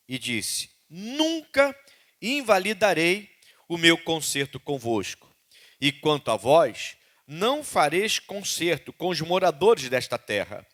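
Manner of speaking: slow, solemn Bible reading; scripture reading. Acted. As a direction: read each verse slowly and with weight. Reads scripture aloud.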